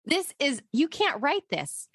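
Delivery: stunned amazement. Retold or imitated. Seeing the orange peel on the balcony, slow awed disbelief. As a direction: draw it out, amazed. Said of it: "And I'm like".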